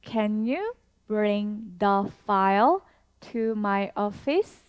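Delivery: very slow, each word spaced out; slow teacher demo with a rising question end; slow model sentence. Shown as a demonstration. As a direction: model it slowly for learners to repeat. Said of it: "한 번 더 해볼까요?".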